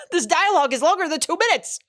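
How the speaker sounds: high-pitched exasperated complaint; playful indignant outburst; mock protest. Mock protest at the anime characters.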